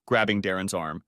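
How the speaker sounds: ordinary delivery, nothing marked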